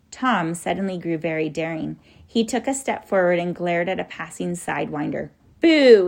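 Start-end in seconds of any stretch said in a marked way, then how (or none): 5.6-6.1 loud, sudden scare shout; yelled boo. A narrator's reading. Tom daringly scares a passing sidewinder, loud yell. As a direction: burst out loudly to startle. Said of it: "he yelled".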